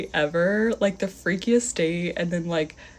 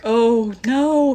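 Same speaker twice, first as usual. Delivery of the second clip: mock-dismayed "oh no", higher and louder; retold dismay; 5 semitones higher, 7 dB louder than usual. Woman as stranger tries grabbing her hand, acted dismay. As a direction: dread as he reaches for you.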